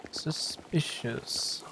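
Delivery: breathy whisper, slow and drawn out; a slow suspicious whisper to yourself. Acted. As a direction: hush it like a private suspicion. Muttered reading of game line.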